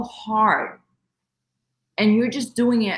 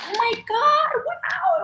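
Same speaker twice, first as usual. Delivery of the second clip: much higher, rising awestruck gasp of words; amazed; slower than usual. Retold or imitated. Family seeing the marlin jump, high amazed wow. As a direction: sound stunned by the giant fish. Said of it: "and we all were like".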